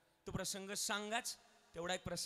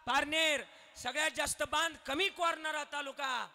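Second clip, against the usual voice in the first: loud, high call and answer; rally the crowd with each line; full-force preaching; 6 semitones higher, 9 dB louder than usual. Acted.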